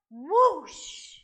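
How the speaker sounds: loud, rushing whoosh; fire-breath whoosh. Acted. Dragons showing real fire breathing, explosive whoosh. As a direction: blast it out like a burst of flame.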